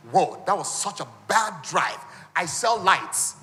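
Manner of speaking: slow, loud seller's pitch; hammed-up ad guy drawing out the words; mock online seller. Imitating a social media light seller, slow and loud. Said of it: "he just tells you".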